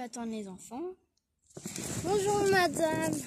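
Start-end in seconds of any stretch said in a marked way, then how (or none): 2.0-3.1 slow, higher, louder sugary greeting; good pupil. Acted. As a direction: play the eager good pupil. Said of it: "je vais faire la bonne élève". (bonne élève qui salue, lent, plus aigu et plus fort)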